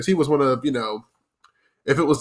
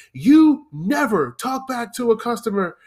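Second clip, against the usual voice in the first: higher-pitched scolding manager; retold yelling; 8 semitones higher than usual. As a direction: scold the new server from up high. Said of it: "immediately starts yelling at me".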